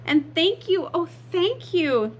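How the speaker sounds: high, effusive thanks; grateful cry. Acted. Witch thanking her rescuers, high grateful cry. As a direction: gush with relieved gratitude. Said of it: "the grateful witch cried".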